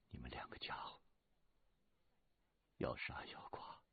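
soft, breathy resigned challenge; hushed defiance. Acted. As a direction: dare them quietly to finish it. 被俘的雪莉杨认命. (低声认命挑衅)

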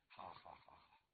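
low, very soft, resigned agreement; quiet assent. A narrator's reading. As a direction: give in gently, voice dropped low. Brother quietly agrees with his sister.

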